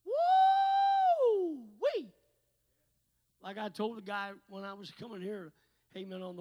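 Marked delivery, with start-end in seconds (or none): 0.1-2.1 very high loud holler; high whoop. Real, not acted. Preacher hollers in delight, high and loud. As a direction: whoop for joy.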